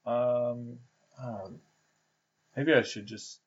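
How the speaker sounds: ordinary delivery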